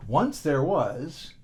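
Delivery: usual speaking voice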